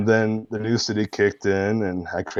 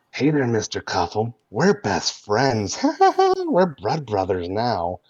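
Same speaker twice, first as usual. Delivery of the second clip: creepy friendly voice; 4 semitones higher than usual. Retold or imitated. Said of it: "just that voice".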